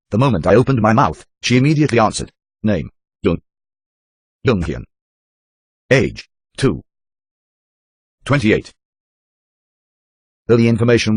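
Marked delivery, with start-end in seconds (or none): 2.6-8.7 halting, timid captive woman; a hint of a scared prisoner.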